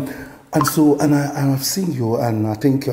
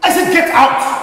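very loud, high yell; angry shouting; 10 dB louder than usual. Acted. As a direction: repeat the order at full fury. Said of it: "Stop shouting".

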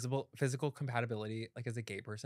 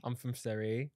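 softer echo in an English accent; British accent; 5 dB quieter than usual. Shown as a demonstration. Repeating the friend's line, tentative British accent. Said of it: "I like British accents".

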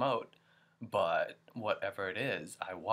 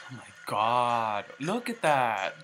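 thrilled; 7 dB louder than usual. Real, not acted. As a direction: shout it out in awe. Thrilled by the video.

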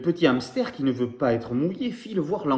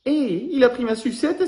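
much higher and louder outraged yelp; indignant cry; 10 semitones higher, 6 dB louder than usual. Acted. Baby koala cries out when the monkey takes the lollipop, in a high indignant voice. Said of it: "s'écrie bébé koala".

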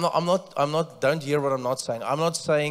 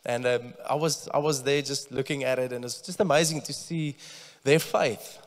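usual speaking voice

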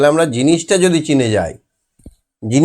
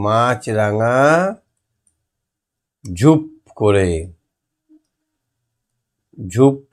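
dictation; much slower than usual. Shown as a demonstration. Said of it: "মাছ ছড়া line তো".

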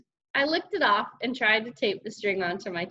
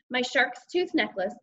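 ordinary delivery

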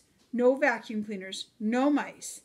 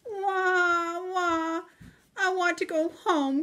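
put-on high, wailing baby mouse voice; crying Baby Mouse; 8 semitones higher than usual. A narrator's reading. Lost Baby Mouse crying to go home, high wail. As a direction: sob it out like a small scared child. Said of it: "cries Baby Mouse".

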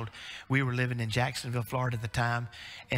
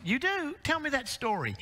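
playful dad; 12 semitones higher than usual. Retold or imitated. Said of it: "father answering child".

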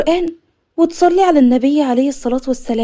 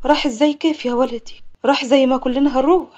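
plain reading voice

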